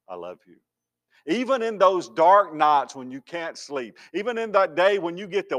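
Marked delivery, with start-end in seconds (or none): 0.1-0.6 very soft, low loving voice; tender hush. Acted. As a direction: breathe it gently to comfort. You know what God says.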